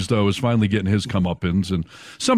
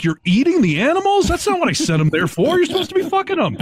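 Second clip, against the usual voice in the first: squeaky high outraged God; high-pitched God voice. Retold or imitated. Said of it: "And God's like".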